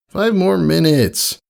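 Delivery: high, slow, drowsy filly voice; sleepy mumble. A narrator's reading. Filly mumbling into her pillow, still half asleep. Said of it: "she mumbled into her pillow".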